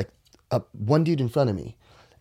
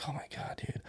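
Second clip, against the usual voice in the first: breathless whispered despair; defeated whisper; 4 semitones higher, 17 dB quieter than usual. Retold or imitated. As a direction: let it out as a hopeless whisper. Sitting defeated by door.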